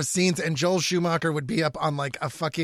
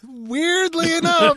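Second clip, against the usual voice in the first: high, slow, put-on silly voice; mock high voice; 10 semitones higher, 4 dB louder, slower than usual. Joking about the plot: slow, high put-on voice. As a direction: stretch it out in a hammed-up high voice. Mock voice after Nora Freeze.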